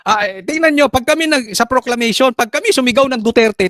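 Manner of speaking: ordinary delivery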